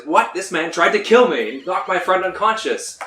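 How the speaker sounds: indignant farmer. Acted. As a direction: protest loudly to the guards. Angry farmer protesting to guards.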